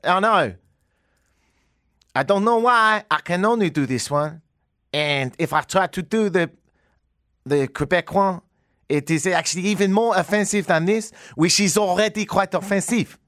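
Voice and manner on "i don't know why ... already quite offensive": hammed-up French accent, higher pitched. Shown as a demonstration. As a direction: put on a comic French accent. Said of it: "but I can do French".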